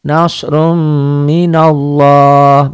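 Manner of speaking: nasrum minallah loud, high and drawn out, long M and "lloh"; thick tafkhim. Shown as a demonstration. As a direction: recite nasrum minallah slowly, swelling on a rounded O. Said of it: "dibaca tebal".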